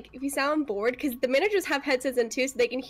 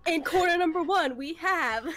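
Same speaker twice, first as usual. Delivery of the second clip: raised, playful ring-announcer intro; esports race announcer; 3 semitones higher, slower than usual. Pretend. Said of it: "an announcer for, uh, for professional esports derby racing".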